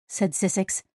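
ordinary delivery, nothing marked

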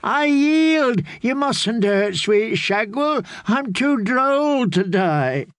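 high, drawn-out wheedling plea; crying out. A narrator's reading. Fool to the swordswoman, begging for mercy. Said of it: "the fool cried".

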